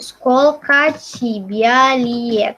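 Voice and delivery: "Skolka Cibialiet" stretched out very slowly; teacher dictating slowly; very slow demo. Shown as a demonstration. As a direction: drag each syllable of "Skolka Cibialiet" for learners to copy. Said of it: "mau nulis aja biar kalian bisa tahu".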